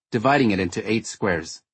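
ordinary delivery, nothing marked

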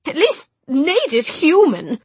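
high, loud, slow cruel jab; cutting retort. Acted. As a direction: deliver the insult slowly.

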